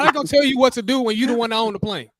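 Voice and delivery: high-pitched, heated protest; incredulous argument. Real, not acted. Friend arguing that the owner makes the rules, incredulous protest. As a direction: argue it high, as if it's absurd. Keeps arguing over who owns planes.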